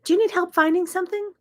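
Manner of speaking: bright, high, quick helpful question; sweet smiling offer. Retold or imitated. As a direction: smile through it, overly helpful. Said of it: "I just smile and I say".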